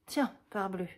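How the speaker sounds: usual speaking voice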